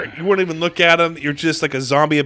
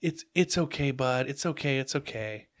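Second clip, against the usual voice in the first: soft, consoling reassurance; 12 dB quieter than usual. Retold or imitated. Employees soothing their tearful manager, softly consoling. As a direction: gently soothe a crying manager. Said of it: "everyone's like".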